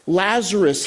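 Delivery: ordinary delivery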